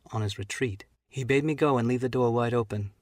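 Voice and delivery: usual speaking voice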